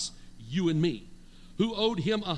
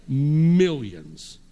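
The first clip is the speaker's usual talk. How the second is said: MILLIONS loud and drawn out; emphatic shout; 5 semitones lower, 11 dB louder than usual. Shown as a demonstration. Preacher showing the congregation how big the debt is, emphatic shout. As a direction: boom out a long MILLIONS. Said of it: "somebody say millions".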